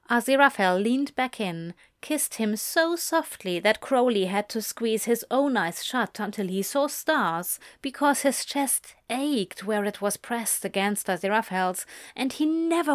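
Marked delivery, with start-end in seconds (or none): none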